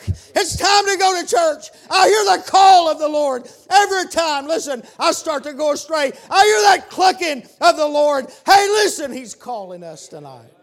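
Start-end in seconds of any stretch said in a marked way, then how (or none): 0.1-9.1 shouted, much higher and louder preaching; full force preaching. Acted. Preacher crying out to the congregation, full shout. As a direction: lift the congregation with it.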